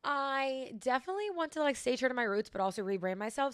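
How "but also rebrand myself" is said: usual speaking voice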